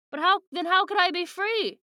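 high-pitched, bewildered plea; distressed protest. Acted. Podcaster retelling a book character's disbelief, distressed protest. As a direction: act out a baffled, rising protest. Acts out Jake's distressed question.

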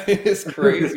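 amused, high and drawn out through laughter; laughing in amazement. Real, not acted. Laughing at the art on show.

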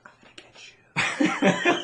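high, slow whispered threat; mock spooky whisper; creepy whisper. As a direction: breathe it like a ghost. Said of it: "I'd be like".

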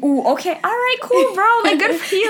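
high-pitched sarcastic congratulations; swooping, sarcastic praise; mock impressed. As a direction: feign being impressed, voice jumping high. Mock congratulates the tribe boaster.

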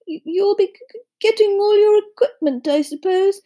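high-pitched, terrified stuttering; jittery, halting timid professor; frightened stammer. A narrator's reading. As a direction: stammer through it, scared of the thought. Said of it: "He looked terrified".

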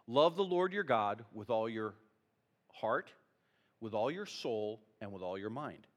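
low, hushed reading with pauses; solemn scripture reading. Real, not acted. Pastor reading Jesus' reply to the congregation, solemn scripture reading.

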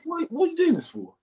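high-pitched, baffled objection; incredulous protest. Retold or imitated. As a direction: reenact protesting an absurd overreaction. Retold protest at teacher's reaction.

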